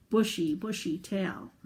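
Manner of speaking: ordinary delivery, nothing marked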